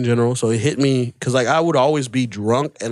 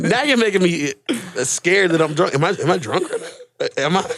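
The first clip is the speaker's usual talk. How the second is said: higher-pitched, laughing self-doubt; laughing protest; 8 semitones higher than usual. Real, not acted. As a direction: protest through real laughter, pitch climbing. Teased about sounding drunk, laughs.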